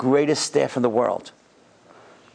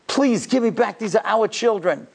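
higher-pitched, earnest begging; pleading; 6 semitones higher than usual. Retold or imitated. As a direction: beg for the children's return. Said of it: "I pleaded, I begged him".

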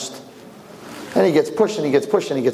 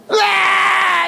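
high imitated baby shriek; newborn scream; 3 dB louder than usual. Baby smacked by the doctor, high imitated scream.

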